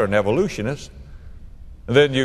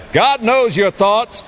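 emphatic proclamation; 9 semitones higher, 5 dB louder, slower than usual. Real, not acted. Climactic sermon point.

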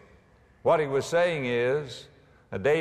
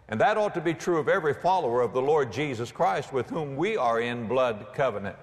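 usual speaking voice

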